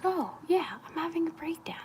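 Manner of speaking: high, sing-song "oh yeah, breakdown"; a lightly mocking high inner voice; mock realization. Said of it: "and it's like".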